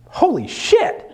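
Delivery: very high, slow amazed holy shit; high drawn-out stunned exclamation; stunned disbelief. Retold or imitated. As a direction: gasp it out in disbelief. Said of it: "and I quote".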